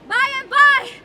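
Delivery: loud call to someone outside. Acted. Husband calling to the maid outside, loud. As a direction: raise the voice to carry through the door. Othello calls toward the door.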